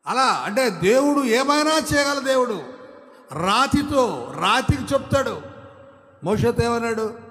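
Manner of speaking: preaching shout. Acted. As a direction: thunder out God's power. Preaching God's power. (దేవుని శక్తిని అరిచి ప్రకటించడం)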